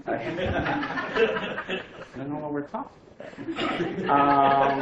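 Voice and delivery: laughing through the words, slowly. Real, not acted. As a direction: laugh at how absurd it all was. Laughing at the absurd meeting.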